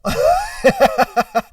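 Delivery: high, loud cackle; laughing. Real, not acted. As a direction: burst out in real, high laughter. Cracks up at the mosquito curse.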